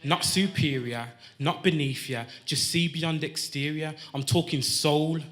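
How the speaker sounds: measured, rhythmic performance poetry; spoken-word poem. Acted. Poet tells his birth story to the crowd, spoken-word delivery. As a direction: ride the rhymes, setting each phrase apart.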